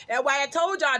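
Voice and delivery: usual speaking voice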